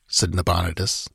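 ordinary delivery

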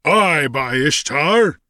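slow, high bull-like bellow. A narrator's reading. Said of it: "bellowed a bull-like voice".

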